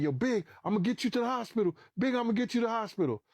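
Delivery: fast, high, repeated promise; retold urgent reassurance. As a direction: reenact rushing to reassure the wounded friend. Retold urgent promise to wounded man.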